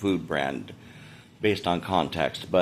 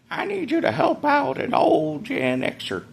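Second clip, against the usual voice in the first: put-on high, drawn-out old-timer plea; slower than usual. Vlogger begs viewers for help, high creaky old-timer voice. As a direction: plead slowly in a high, aged voice.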